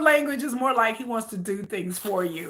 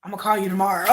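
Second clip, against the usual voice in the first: lower, slightly laughing brush-off; mock evasive boyfriend; 7 semitones lower, 3 dB quieter, faster than usual. [name] brushing off his girlfriend, low and evasive. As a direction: slide out of the conversation.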